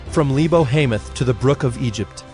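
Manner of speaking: usual speaking voice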